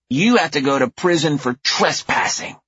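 put-on high child verdict; stern little girl. A narrator's reading. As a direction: sentence him like a tiny judge. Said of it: "Louise says".

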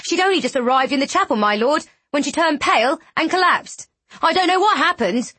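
high, rushed, alarmed report; panicked. A narrator's reading. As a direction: blurt it out in rising panic. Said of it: "came a panicked voice".

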